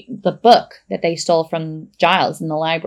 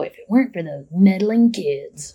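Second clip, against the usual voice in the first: lightly voiced foiled cartoon villain; a hint of cartoon baddie; Scooby-Doo villain. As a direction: grumble it like an unmasked crook. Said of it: "What do they say in Scooby-Doo?".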